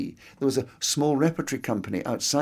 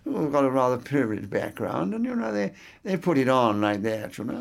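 affected, put-on la-di-da English accent. Shown as a demonstration. Actor showing listeners what a put-on accent is like, la-di-da accent. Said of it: "the la-di-da accent".

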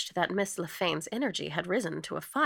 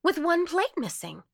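high, loud exclamation; pitched up, bursting in loudly; excited cry; 11 semitones higher, 6 dB louder than usual. A narrator's reading. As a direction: cry out in eager recognition. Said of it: "cried Martha Pym".

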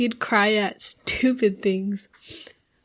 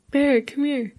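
lightly voiced dad calling, a little higher; 5 semitones higher than usual. Dad calls child over to hug him, calling out. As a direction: call your kid over for comfort.

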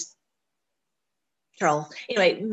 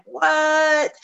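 surprised; 8 semitones higher, 4 dB louder than usual. Retold or imitated. Imagined reaction of surprise.

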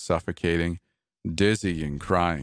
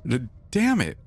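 higher, jolted awake cry of "damn"; startled shout; 7 semitones higher than usual. Acted. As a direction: she snaps awake and blurts it out. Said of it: "my wife shouted".

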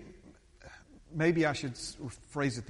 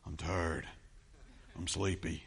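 low, subdued, weary answer; tired reply; 8 semitones lower, 5 dB quieter, slower than usual. Retold or imitated. Pastor admitting his first response this morning, low and tired. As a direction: drop the pitch as if worn out. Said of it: "my first response was".

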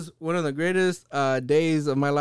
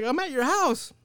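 put-on quoting Kid; 8 semitones higher than usual. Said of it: "And then Kid was like".

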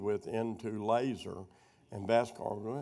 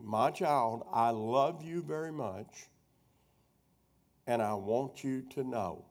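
slow dictation; slower than usual. Shown as a demonstration. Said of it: "So write on your paper".

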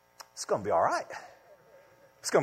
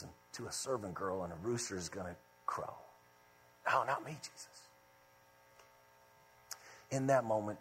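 much softer, hushed sermon voice over music; soft reflective preaching; 11 semitones lower, 8 dB quieter than usual. Acted. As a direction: draw them in quietly and tenderly.

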